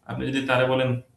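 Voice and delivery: normal talking voice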